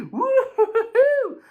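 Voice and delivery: high, slow mocking woo-hoo cackle; mocking laugh. Rival taunting him from his window, high mocking laugh. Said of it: "He's like".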